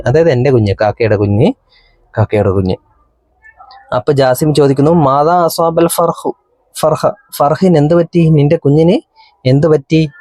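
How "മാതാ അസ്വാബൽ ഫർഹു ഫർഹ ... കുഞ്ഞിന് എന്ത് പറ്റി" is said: voicing Jasim. Teacher voices Jasim asking the crow, concerned and higher. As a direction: lightly play the worried boy asking.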